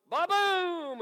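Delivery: loud booming thunder noise. Acted. Storyteller booms the thunder loudly. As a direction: boom it out like thunder.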